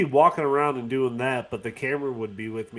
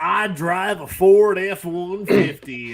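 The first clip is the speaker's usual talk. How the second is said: louder, higher mock country accent; hammed-up Southern American; 7 semitones higher, 7 dB louder than usual. Guessing how the friend's American accent would sound, mock country. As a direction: play a country truck driver. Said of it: "I thought it was gonna be like".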